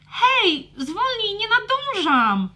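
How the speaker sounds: high, loud breathless protest; high dragon girl complaining. Acted. Trelka being dragged along, high and protesting. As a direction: call after him, struggling to keep up.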